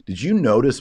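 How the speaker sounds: normal talking voice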